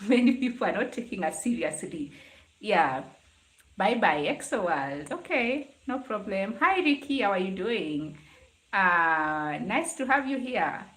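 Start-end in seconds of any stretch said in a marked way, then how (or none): none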